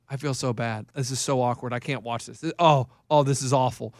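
low, soft, uncomfortable murmur; imitated cringing wife. Wife watching the golfer fail, hushed cringing retelling. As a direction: voice drops right down, as if unable to watch. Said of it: "my wife was like".

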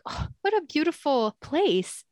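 high, airy mock delight; retold wonder. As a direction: act out admiring the scenery. Retold delight while walking around.

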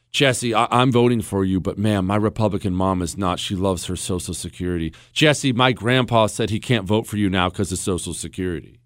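low, softened, mildly apologetic retelling; reluctant supporter. Retold or imitated. Republican supporter to candidate about mom's worries, imitated reluctant voter. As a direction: let a voter down the candidate gently, pitched low.

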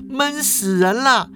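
put-on high-pitched bratty girl voice; girl complaining the game contest was dull; Ye Feng rolling eyes. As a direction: roll your eyes and whine high. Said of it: "叶枫就两眼一翻啊".

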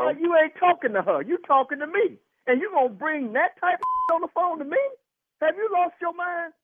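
high, heated confrontation; real anger. Real, not acted. Husband confronting doctor on the phone, furious. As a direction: confront him, pitched right up in outrage.

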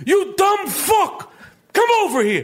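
hammed-up enraged boss; Danny Aiello. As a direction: summon someone with furious impatience. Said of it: "he'd be saying this".

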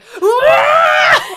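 loud, high-pitched screech of rage; hammed-up furious shriek; mock tantrum scream. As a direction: imitate a creature throwing a fit. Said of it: "he literally has a fit about it".

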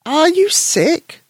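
high, slow granny voice; a caring grandmother asks gently. Acted.

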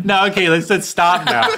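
laughing a nervous plea while pinned. Shown as a demonstration. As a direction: laugh it off, half scared. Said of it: "do that laugh like you probably used to do with your siblings".

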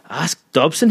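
incredulous cry. Acted. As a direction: fling the name back in disbelief. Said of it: "he cried".

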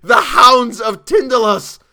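very high, slow, full shout; hysterical shriek. A narrator's reading. Chalmers naming the creatures he fears, shrieking hysterically. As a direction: shriek the dreaded name, drawing it out. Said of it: "he shrieked".

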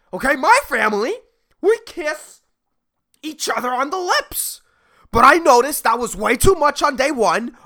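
high, loud indignant rant; ranting CEO. Retold or imitated. Hugging CEO justifying himself to Sharon, high loud rant. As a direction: rant like a wounded man-child.

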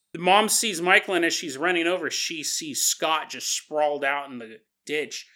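usual speaking voice

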